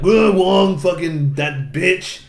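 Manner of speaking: high, loud outburst; exasperated mock curse. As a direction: groan it in hammed-up outrage. Master Asia curses Wong.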